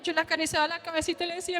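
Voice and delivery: normal talking voice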